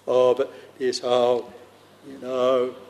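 ordinary delivery

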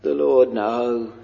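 ordinary talk

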